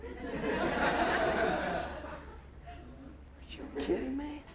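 breathless, soft laughing disbelief; can't believe it, laughing into the words. Real, not acted.

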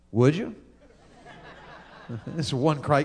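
normal talking voice

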